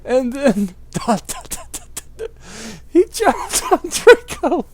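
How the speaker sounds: high, breaking laughter through the line; real laughter cracking the narration; laughing through words. Real, not acted. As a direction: barely hold back laughter.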